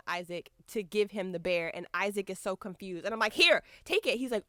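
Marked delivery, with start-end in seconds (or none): 3.3-4.2 higher, louder urging; urgent handing over. Retold or imitated. Fan urging guard to take bear, insistent. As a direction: push it on them, loud.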